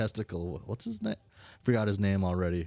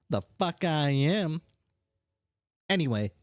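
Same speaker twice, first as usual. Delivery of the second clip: higher, bossy troll voice; pushy brash character; 9 semitones higher than usual. Acted. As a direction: press them like an impatient schemer. Voices the brash character pressing the other.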